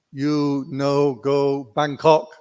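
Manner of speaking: slow broken English, a hint of a Burmese accent; the teacher's slow, Burmese-accented broken English. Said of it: "he say, in English".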